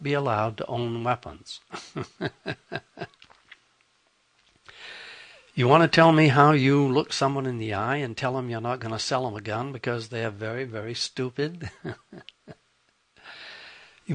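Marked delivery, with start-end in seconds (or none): 1.7-3.1 soft unvoiced chuckle; breathy laugh. Real, not acted. Laughs at own joke. 11.8-12.5 quiet breathy chuckle; a little laugh under the breath; soft laugh. Real, not acted. Laughs at own joke.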